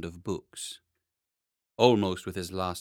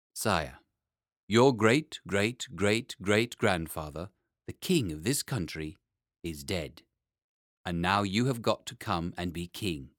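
lower, slow and solemn courtier; grave old gentleman; 3 semitones lower than usual. Acted. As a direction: announce the news with heavy dignity.

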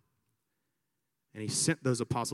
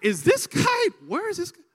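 much higher, loud incredulous squeak; mock disbelief; 6 dB louder than usual. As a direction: act stunned. Retelling his own disbelief.

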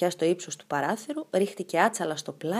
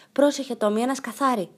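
louder, higher warning call to Tommy; 5 semitones higher, 4 dB louder than usual. A narrator's reading. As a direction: call out so Tommy doesn't step on it. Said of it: "φώναξε η Πίπη". (Πίπη φωνάζει στον Τόμι να προσέξει το σκαθάρι, δυνατά)

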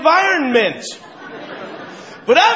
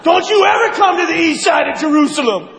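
furious Jesus; 5 semitones higher than usual. Acted. Jesus yelling at the money lenders, high and furious. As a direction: explode at the bankers. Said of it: "even Jesus on that one day was like".

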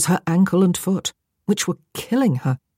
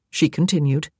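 ordinary delivery, nothing marked

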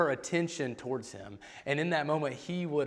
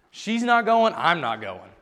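acted refusal; 4 semitones higher, 8 dB louder, slower than usual. Acting out girls' stubborn refusal.

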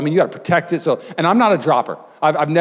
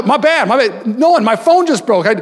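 panicked; 10 semitones higher, 4 dB louder than usual. Retold or imitated. Panicking after phone breaks.

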